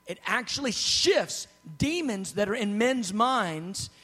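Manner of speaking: slow, weighty quote. Retold or imitated. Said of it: "He goes".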